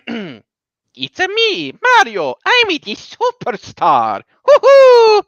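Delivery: high, loud, slow Italian plumber with a whooping cheer; Mario impression. Sonic tops his Italian accent with a Mario voice. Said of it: "I got a better one for you".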